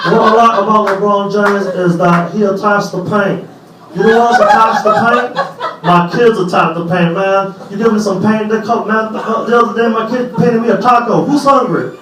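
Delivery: Barkley going off on a tangent at halftime; Charles Barkley impression. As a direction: ramble like a TV pundit off topic. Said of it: "he'll be at the halftime show like".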